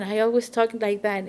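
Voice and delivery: normal talking voice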